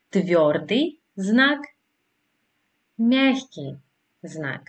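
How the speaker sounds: Τβιόρντι, знак, μιάχκι, знак said one word at a time with pauses; Russian pronunciation demo. Shown as a demonstration. Teacher inviting learners to try, Russian pronunciation demo of Τβιόρντι знак, μιάχκι знак. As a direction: model Τβιόρντι знак and μιάχκι знак, each word set apart. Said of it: "Δοκιμάστε να το προφέρετε".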